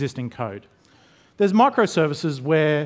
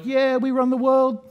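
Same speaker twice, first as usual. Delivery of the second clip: high, put-on boastful chant; playful high-pitched bragging voice; mock cocky developers; 9 semitones higher, 3 dB louder than usual. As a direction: imitate a crowd crowing about itself. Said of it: "everyone's like".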